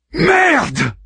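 high furious roar; roared curse. A narrator's reading. Harman cursing as the patients die, roaring. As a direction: spit out the curse in rage. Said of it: "rugit Harman".

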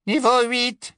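high, detached announcer witch; a chilly, impersonal lift announcement; cold witch voice. A narrator's reading. The lift's cold witch voice announcing the floor, detached. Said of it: "la voix froide de la sorcière".

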